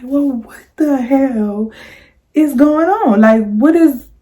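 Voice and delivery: high, stretched-out what the hell; slow stunned disbelief; shocked disbelief. Retold or imitated. Seeing her ex in the room, slow stunned disbelief.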